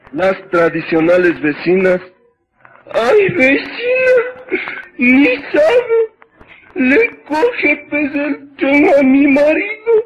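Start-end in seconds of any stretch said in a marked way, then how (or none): 2.9-9.9 high, crying wail, hammed up; mock wailing neighbour. Neighbour wails to neighbour about her husband, mock sobbing. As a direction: sob out the tale for laughs.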